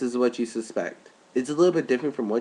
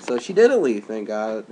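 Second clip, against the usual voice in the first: ordinary talk, nothing marked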